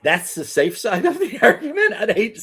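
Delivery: high, laughing disbelief. Real, not acted. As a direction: laugh through the question. Incredulous laugh at odd example.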